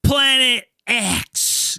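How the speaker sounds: drawn-out, high announcer welcome; show announcer. Pretend. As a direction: stretch the title out like a big show intro. Podcast host announcing the show intro.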